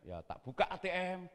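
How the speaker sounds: normal talking voice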